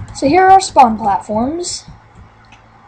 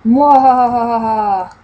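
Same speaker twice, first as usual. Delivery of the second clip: slow hammy villain cackle; a drawn-out mad scientist laugh; evil laugh; 4 dB louder, slower than usual. Acted. Evil laugh after trap.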